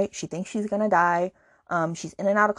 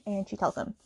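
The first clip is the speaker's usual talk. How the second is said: ordinary talk, nothing marked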